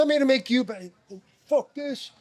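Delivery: high-pitched, worked-up mock tirade; retold angry outburst. Retelling a star player's blow-up at him in scrimmage, angry outburst. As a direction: act out a fed-up player blowing up.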